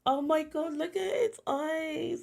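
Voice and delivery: high, slow cooing over cute eyes. Real, not acted. Gushing at the chick's eyes, slow high cooing.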